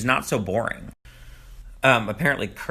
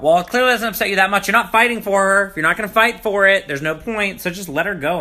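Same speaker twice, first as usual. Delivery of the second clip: scolding; 7 semitones higher, 5 dB louder than usual. Real, not acted. Scolding Colton on TV.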